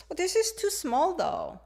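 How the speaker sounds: high-pitched dismayed exclamation; surprised complaint. Acted. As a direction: exclaim about a bad fit. Acting out trying on small clothes.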